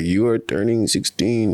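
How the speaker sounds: mock Russian or German accent; bad Russian-German accent. Imagined German or Russian parent announcing sixteenth birthday, mock accent. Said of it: "in Russia or Germany, they're like".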